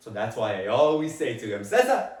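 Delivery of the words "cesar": calling out. Acted. Father calls his son's name.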